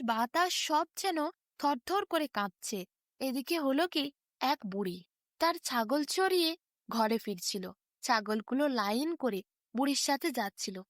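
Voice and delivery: ordinary delivery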